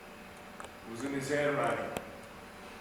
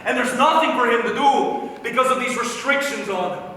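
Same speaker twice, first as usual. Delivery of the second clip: loud, worked-up venting; heated, raised-voice tirade; outraged rant; 3 semitones higher, 4 dB louder than usual. Acted. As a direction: rant in loud disbelief at the senselessness of it. Outraged rant about brother's danger.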